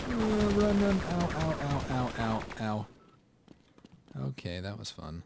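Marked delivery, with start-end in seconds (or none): 0.1-2.9 panicked yelling while fleeing; high, loud, frantic cries of pain; being chased. Real, not acted. Fleeing a turret, getting shot.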